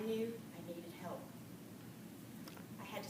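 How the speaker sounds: soft, low, remorseful admission; quiet confession. Real, not acted. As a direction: own up softly, full of regret. Quietly admitting her own fault.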